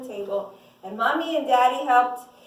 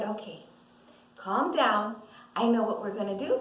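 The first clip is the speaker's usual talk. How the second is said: low, softened reassurance; calming father; 6 semitones lower than usual. Acted. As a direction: settle a panicked wife, voice dropped. Father calms the panicking mother.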